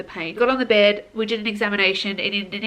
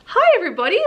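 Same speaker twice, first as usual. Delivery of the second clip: much higher and louder, bright and bubbly; sudden relief; 13 semitones higher, 8 dB louder than usual. Retold or imitated. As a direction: burst back to life, greeting everyone. Epidural works, comes out of fog.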